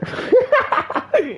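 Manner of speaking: squeaky high burst of laughter. Real, not acted. Laughing at his own streamer impression, high squeaky laugh. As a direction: crack up high.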